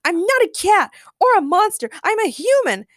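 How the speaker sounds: high, loud, indignant creature voice; shouted. A narrator's reading. Cat creature objects to Ray, shouting indignantly. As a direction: protest at a high-pitched shout. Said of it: "shouted the cat-like creature".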